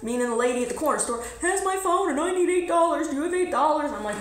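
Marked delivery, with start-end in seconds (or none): 1.4-3.9 high, slightly put-on voice for the young man; voicing Malik. Malik tells her the store lady has his phone, lightly voiced. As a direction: play the man spinning a story for cash.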